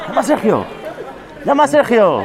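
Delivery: a high shouted call to a friend. Real, not acted. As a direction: call out to get Sergio's attention. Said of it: "¿Ves cómo se puede chillar?".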